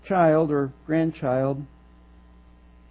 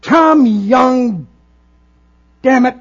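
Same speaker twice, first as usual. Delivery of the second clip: higher and louder, a hammed-up angry outburst; mock angry curse; 6 semitones higher, 9 dB louder, slower than usual. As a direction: bark the name like a swear word. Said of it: "Or if we were angry".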